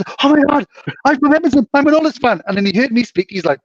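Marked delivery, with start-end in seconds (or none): 0.2-2.4 high, eager mock gush; imitating an excited fan. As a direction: retell a thrilled stranger's cry, pitched up. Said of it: "He was super excited".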